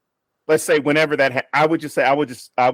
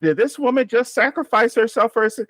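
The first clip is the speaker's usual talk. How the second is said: mom talking to the screen; 8 semitones higher than usual. Retold or imitated. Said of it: "She's like".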